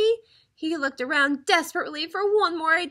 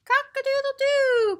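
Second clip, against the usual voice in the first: crowing rooster; 12 semitones higher, 4 dB louder than usual. A narrator's reading. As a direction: crow like a rooster at dawn. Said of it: "crowed Rooster".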